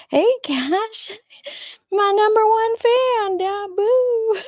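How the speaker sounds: high, loud, gushing hello; excited greeting. Real, not acted. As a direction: squeal a happy hello to your biggest fan. Greeting favourite fan in chat.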